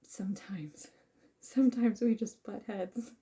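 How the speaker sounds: soft laughing whisper. Real, not acted. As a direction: whisper it with a giggle. Laughing aside about butting heads.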